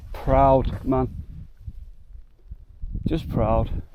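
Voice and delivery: words catching with emotion, near tears; choked-up pride. Real, not acted. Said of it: "I'm in my emotional wreck".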